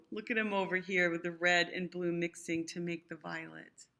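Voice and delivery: ordinary delivery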